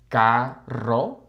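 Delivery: "carro" split as ka, rro, trill starting the second; syllable by syllable. Shown as a demonstration. Helping learners, syllable-split trilled "carro". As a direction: break "carro" before the rolled R. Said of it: "break up the words into syllables".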